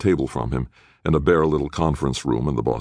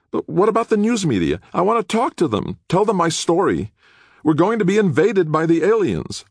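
put-on very high, urgent young man; agitated young prisoner; 5 dB louder than usual. Nick demanding to tell the media, agitated. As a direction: insist desperately, pitched right up.

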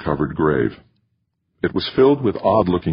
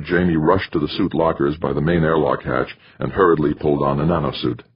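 plain reading voice

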